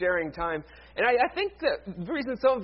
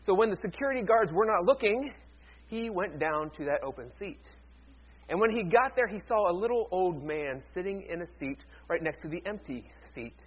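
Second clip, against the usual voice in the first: ordinary delivery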